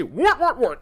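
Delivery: sudden high startled what; jolted by something in chat. Real, not acted. Streamer jolted by a chat message, startled.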